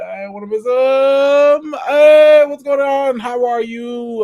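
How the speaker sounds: loud, high hype shout-out; big loud high welcome; excited greeting. Real, not acted. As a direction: greet them like a long-lost friend. Excited greeting of arriving viewer.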